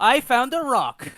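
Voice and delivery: high, slow, loud triumphant cry; excited shout. Acted. Player holding a stone overhead, shouting with glee. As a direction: proudly bellow the find. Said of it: "hold the stone up overhead and say".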